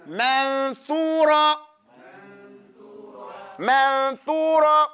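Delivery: Manthura said very slowly, the th clear; slow drawn-out demo. Shown as a demonstration. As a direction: stretch it out for students. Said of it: "Not ma, ma".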